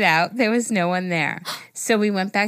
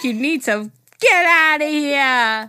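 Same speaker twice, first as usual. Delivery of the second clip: slow, higher, louder mock wail; acted banishing command; 6 semitones higher, 5 dB louder, slower than usual. Co-host plays the child ordering a spirit out, hammed-up wail. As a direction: drag it out like a hammed-up exorcism. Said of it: "Just like".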